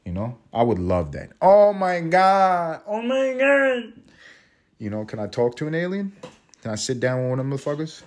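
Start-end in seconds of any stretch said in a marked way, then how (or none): 1.4-3.9 very high, slow, drawn-out "oh my God"; awed excitement. Real, not acted. Host dreams of meeting aliens, gasps with high slow awe. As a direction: swoon over the idea, stretching each word way up.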